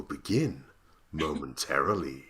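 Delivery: low, hushed, suspenseful announcement; mysterious announcer. Shown as a demonstration. Announcer building suspense before the show begins, low and mysterious. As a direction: drop down low and draw out the mystery. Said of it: "then going more mysterious".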